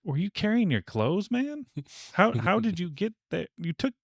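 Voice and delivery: hammed-up confused bystander grilling Clark; mock baffled onlooker. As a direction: squeak the questions in disbelief. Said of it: "but it's like".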